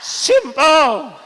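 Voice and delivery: one word flung out high and loud; shouted answer. Real, not acted. Preacher answering his own question with a loud, high shout. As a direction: shout it to the crowd.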